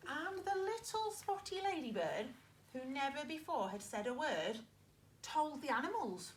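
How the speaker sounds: put-on lively read-aloud for young children; story narration. Teacher reading a farm story to her pupils, lively. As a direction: read it like a picture book to a class.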